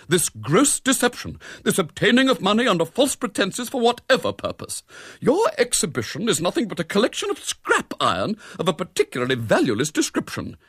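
high-pitched, righteous accusation; haughty scolding; dramatic denunciation. A narrator's reading. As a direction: lay out the charges indignantly. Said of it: "in dramatic denunciation".

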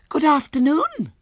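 put-on very high, loud, prim child; prim little girl. A narrator's reading. Little girl greets William, prim and very high. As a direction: greet primly in a tiny girl's squeal. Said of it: "said Violet Elizabeth primly".

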